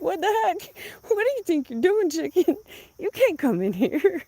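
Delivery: high, loud scolding of a chicken; startled scolding. Retold or imitated. Scolding a hen that walked into the house, high and loud. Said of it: "I was like".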